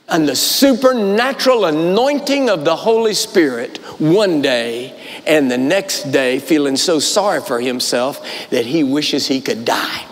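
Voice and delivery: ordinary delivery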